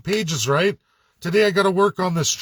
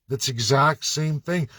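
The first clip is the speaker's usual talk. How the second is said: ordinary talk, nothing marked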